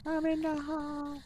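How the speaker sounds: high, slow mocking repeat; mocking echo. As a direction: parrot it back teasingly. Echoing a friend mockingly.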